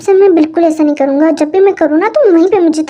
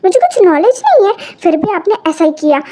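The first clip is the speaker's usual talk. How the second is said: ordinary talk, nothing marked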